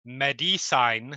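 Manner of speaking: "medicine" slowly as meh-dee-sine; misspelled pronunciation. Shown as a demonstration. Player reading the sheet's misspelling aloud, "medicine" said meh-dee-sine. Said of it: "as the sheet calls it".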